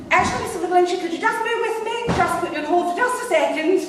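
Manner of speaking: high-pitched, frantically polite posh receptionist; put-on posh, pitched right up; posh phone voice. Receptionist putting the caller on hold, high and posh. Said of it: "I decided I'd be rather posh".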